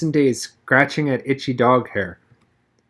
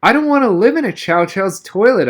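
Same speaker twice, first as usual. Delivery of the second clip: higher, louder whiny complaint; complaining; 8 semitones higher, 4 dB louder than usual.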